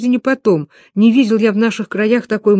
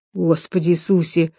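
usual speaking voice

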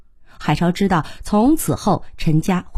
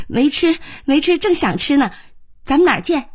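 high-pitched, eager phone reply; keen youngster agreeing to meet; eager young character; 8 semitones higher than usual. A narrator's reading. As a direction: jump at the lunch invitation in a high voice. Eager phone reply while leaving.

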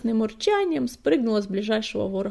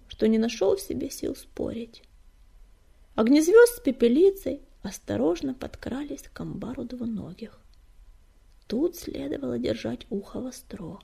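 usual speaking voice

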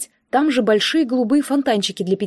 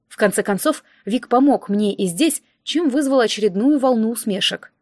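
usual speaking voice

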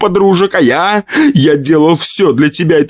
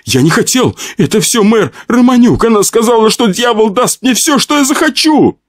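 high, desperate excuses; whining. Acted. Pyotr blaming the mayor to save himself, whining. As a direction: whine it out, climbing higher. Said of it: "заскулил Пётр Трубинин".

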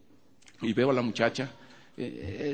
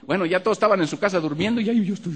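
quick, high, louder; fast excited telling; 9 semitones higher, 5 dB louder, faster than usual. Real, not acted. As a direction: rush it out. (relato rápido y exaltado)

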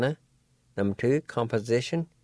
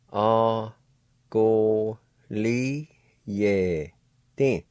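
slow demo; much slower than usual. Shown as a demonstration. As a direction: go li ye din drawn out slowly. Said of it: "Second part".

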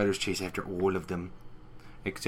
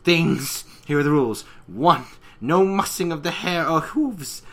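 higher and louder, laying down rules; prissy Rarity voice; 7 semitones higher, 7 dB louder than usual. Retold or imitated. Rarity setting rules for the spiders, prim and loud. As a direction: lecture the monsters primly. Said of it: "And then Rarity goes".

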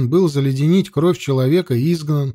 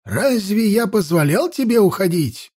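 put-on stern, higher voice of an elderly priest; sharp old man voice; 5 semitones higher than usual. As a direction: question him like a cranky old man. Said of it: "резкий старческий голос Невида".

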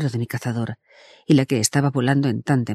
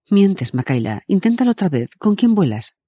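accusing; 7 semitones higher than usual. A narrator's reading. Accusing over radio.

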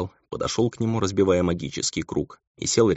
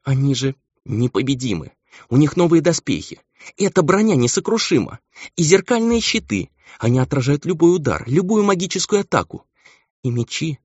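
high-pitched, agitated listing; upset, overwrought voice; distraught insisting; 10 semitones higher than usual. A narrator's reading. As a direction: insist desperately, close to tears. Upset angel insists enemies are invincible.

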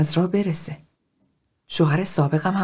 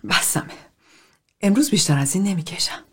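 softer, muttered through light sobs; sobbing under breath; 3 dB quieter than usual. A narrator's reading. Bonnie tells the therapy group she's had enough, mildly sobbing. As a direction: murmur it like you're all cried out.

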